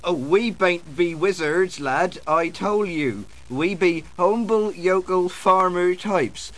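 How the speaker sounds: put-on West Country yokel; yokel accent. Wizards disguised as farmers fob off a lad, yokel accent. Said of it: "disguised as yokels".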